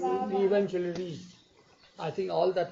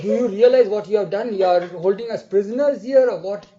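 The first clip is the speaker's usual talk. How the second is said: higher and much louder indignant protest; retold angry shouting; 4 semitones higher, 9 dB louder than usual. Locked-in women yelling at the driver, loud and indignant. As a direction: shout it back like trapped, furious passengers. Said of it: "they shouted at me".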